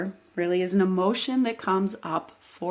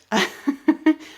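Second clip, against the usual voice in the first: bright burst of laughter; real laugh; 8 semitones higher, 5 dB louder than usual. Real, not acted. Vlogger laughing at her own rant, amused laugh. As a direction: laugh out loud at yourself.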